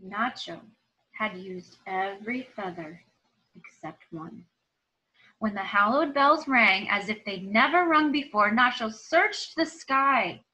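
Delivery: lively, expressive storybook reading; reading to children. Acted. Teacher reading a story aloud to her students, expressive storytelling. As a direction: tell it like a picture book for the class. Reads picture book aloud.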